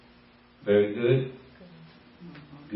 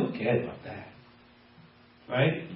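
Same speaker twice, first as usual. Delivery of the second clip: ordinary talk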